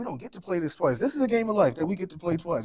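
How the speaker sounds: urgent plea. Retold or imitated. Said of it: "it's just like".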